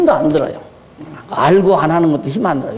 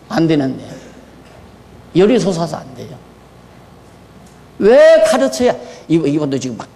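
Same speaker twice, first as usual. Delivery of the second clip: usual speaking voice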